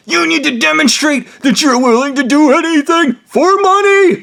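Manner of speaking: high-pitched mocking imitation, climbing to a shriek; hammed-up outraged retort. Retelling the other man's angry retort.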